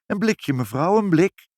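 high, emphatic sales pitch; lightly voiced shopkeeper. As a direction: sell it to the customer, pitch lifted. Shopkeeper pitching his wares. (licht aangezette, aanprijzende winkelier)

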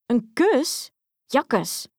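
high, incredulous yuck; disgusted exclamation. A narrator's reading. Princess asked for a kiss by frog, disgusted exclamation. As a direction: cry it out in revulsion. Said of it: "riep de prinses".

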